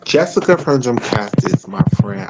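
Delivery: ordinary delivery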